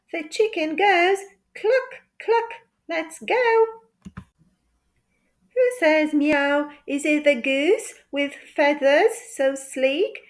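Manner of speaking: ordinary delivery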